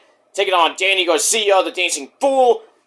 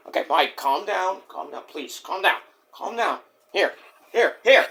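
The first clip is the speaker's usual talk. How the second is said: soft, hushed placating; soothing; 6 dB quieter than usual. Pretend. Host calming a worked-up wrestler, soft and pleading. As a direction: talk him down quietly.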